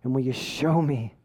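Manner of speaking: low, soft self-searching plea; low, subdued appeal to God; humble prayer. Acted. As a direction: ask God quietly, with pauses. Humble prayer after mock rant.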